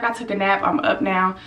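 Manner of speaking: ordinary talk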